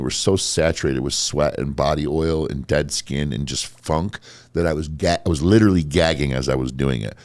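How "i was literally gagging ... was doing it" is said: normal talking voice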